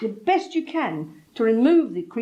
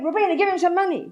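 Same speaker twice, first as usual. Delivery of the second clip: higher, coaxing plea; sister's urging; 9 semitones higher than usual. Retold or imitated. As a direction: urge her sister to pay up. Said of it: "And she kept saying".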